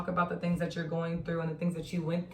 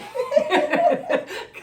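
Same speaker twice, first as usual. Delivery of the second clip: high loud burst of laughter; real high cackle; high laughing shriek; 7 dB louder than usual. Real, not acted. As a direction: let out a squeal of laughter. Laughing hard.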